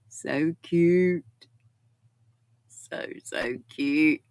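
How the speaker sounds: slow whispered awe at a cute pet; cooing whisper. Real, not acted. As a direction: breathe it out like adoring a puppy. Cooing over a pet photo.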